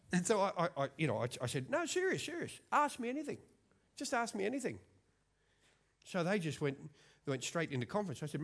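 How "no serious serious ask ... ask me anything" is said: hammed-up boast. Boasting to kids.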